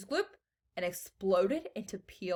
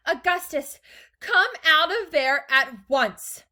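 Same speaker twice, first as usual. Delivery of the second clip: loud, high, slow command; yelled; 8 semitones higher, 11 dB louder, slower than usual. A narrator's reading. Mother orders Augustus out of the pipe, yelled. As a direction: order a child out, loudly. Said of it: "yelled Missus Gloop".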